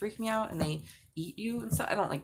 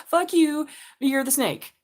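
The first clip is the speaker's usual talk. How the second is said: louder, higher playful mock curse at the card; hammed-up cursing of the card; 6 semitones higher, 5 dB louder than usual.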